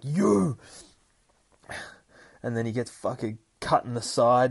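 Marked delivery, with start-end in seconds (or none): 0.0-0.6 high, loud accusing shout; Gabby shouting at Dakin; furious cry. Retold or imitated. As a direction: yell it at him. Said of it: "she's like".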